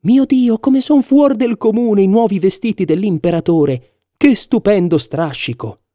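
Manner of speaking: high-pitched crowd admiration; admiring exclamation. A narrator's reading. Townsfolk praising the emperor's new clothes, exclaimed in admiration. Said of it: "tutta la gente esclamava".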